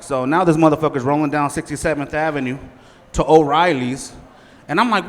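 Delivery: ordinary delivery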